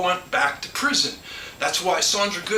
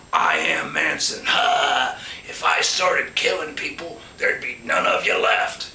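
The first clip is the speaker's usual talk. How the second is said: much lower, slow, hammed-up villain voice with a mock laugh; menacing Manson; 5 semitones lower, slower than usual. Mocking how the story made Manson a killer, low and hammed-up. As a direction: play the killer the press wanted, growling it out.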